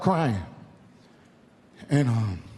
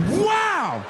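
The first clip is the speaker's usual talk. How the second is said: gunshot yell; 12 semitones higher than usual. Shown as a demonstration. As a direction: blast it like the gun finally fires. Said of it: "it skipped like this".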